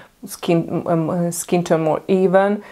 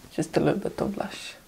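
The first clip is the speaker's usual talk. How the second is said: barely audible whisper; 10 dB quieter than usual. Real, not acted. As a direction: breathe the words out. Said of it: "with a very low voice".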